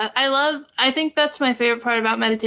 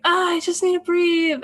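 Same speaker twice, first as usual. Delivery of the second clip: mock anxious; 6 semitones higher than usual. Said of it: "and I was like".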